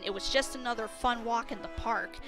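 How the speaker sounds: ordinary delivery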